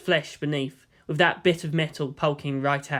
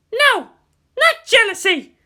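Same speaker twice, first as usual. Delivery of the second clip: much higher, louder flustered denial; indignant shout; 8 dB louder than usual. Acted. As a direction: shout it down hard. Asuka denying jealousy.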